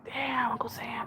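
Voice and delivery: slow, hushed mock dismay; dismayed whisper. Streamer reacting to tax penalties, dismayed whisper. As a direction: whisper it slowly, as if stung.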